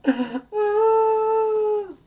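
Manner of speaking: very high, hammed-up baby bawling; wailing Baby Bear. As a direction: draw the words out into a mock sobbing wail. Baby Bear cries over eaten porridge.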